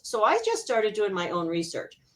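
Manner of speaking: usual speaking voice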